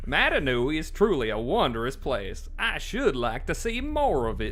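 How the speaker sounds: high, slow, loud cartoon voice; Goofy voice. Voicing a game character that turns into Goofy. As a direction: talk like a goofy cartoon dog. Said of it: "Turning into Goofy".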